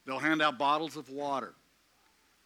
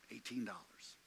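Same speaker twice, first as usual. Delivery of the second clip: breathy whisper, much softer: "Eighteen dollars"; 3 semitones lower, 13 dB quieter than usual. Acted. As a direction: let the sum fall to a hushed whisper. Preacher repeats the small sum.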